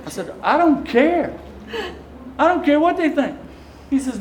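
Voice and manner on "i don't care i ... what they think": crying. Retold or imitated. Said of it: "and I was crying".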